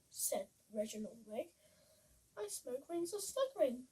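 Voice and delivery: put-on lively read-aloud for children; bouncy rhyming picture-book voice; storybook reading. A narrator's reading.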